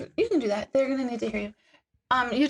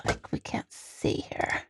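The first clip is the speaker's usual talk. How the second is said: whisper; 8 dB quieter, slower than usual. Real, not acted. Muttered aside off mic.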